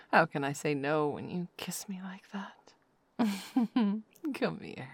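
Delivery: hushed afterglow murmur, mostly whispered; intimate whisper. Acted. Lover settling her partner to sleep, hushed whisper.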